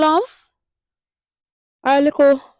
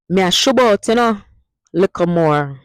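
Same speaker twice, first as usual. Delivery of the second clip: lively Patois goodbye; 2 semitones higher, 6 dB louder than usual. Shown as a demonstration. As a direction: say bye like a Jamaican heading out. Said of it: "And the last one".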